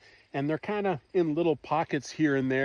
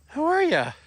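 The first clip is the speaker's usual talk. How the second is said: high, bright greeting to a pet; pet talk; 10 semitones higher, 5 dB louder than usual. Real, not acted. Owner greeting her cat in a high pet voice. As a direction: lift it up high like greeting a puppy.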